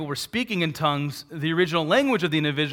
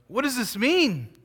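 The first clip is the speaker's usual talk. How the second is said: higher, puzzled question; perplexed crowd; 9 semitones higher than usual. Retold or imitated. Crowd at Pentecost asking each other, higher and puzzled. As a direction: wonder aloud like the amazed crowd. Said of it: "amazed and perplexed, saying".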